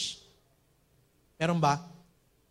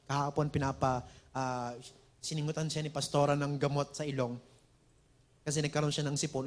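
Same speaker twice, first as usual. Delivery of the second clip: normal talking voice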